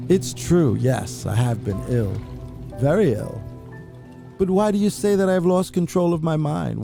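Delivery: usual speaking voice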